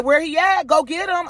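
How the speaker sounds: high, loud taunt; loud high-pitched dare; challenging. Retold or imitated. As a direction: dare him to bring his son out. Challenging the neighbor's son.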